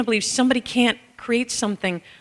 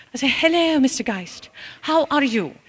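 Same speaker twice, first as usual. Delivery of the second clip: a light put-on British accent, a little higher; 6 semitones higher than usual. Caregiver greeting a patient, lightly British accent. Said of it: "I speak British".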